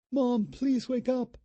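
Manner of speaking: put-on high, slow girl's plea; a frightened daughter begs; pleading young girl. A narrator's reading. Daughter begging her mother to wake up, high and pleading.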